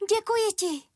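very high tiny bee voice; grateful little bee. A narrator's reading. Freed bee thanks the sun, high little voice. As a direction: say thanks in a squeaky character voice.

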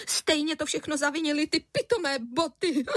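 high, tearful acted wail; sobbing. A narrator's reading. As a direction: blame through tears, ending in a sob. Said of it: "vzlikala paní Středníková".

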